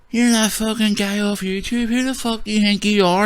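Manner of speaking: sneering impression of a bar heckler; mocking the aggressive guy. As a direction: put on a silly high voice for the troublemaker. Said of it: "this is the way he talk".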